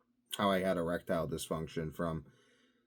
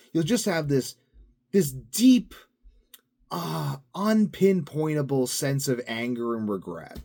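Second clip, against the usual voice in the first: mock dramatic despair; 7 semitones higher, 5 dB louder than usual. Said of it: "mocking alcohol's effects".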